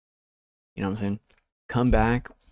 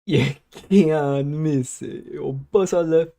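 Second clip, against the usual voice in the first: imitated Jamaican accent, drawn out, higher pitched; 5 semitones higher, much slower than usual. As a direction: copy the Jamaican speaker with long, stretched vowels. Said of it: "like, a Jamaican accent the other day".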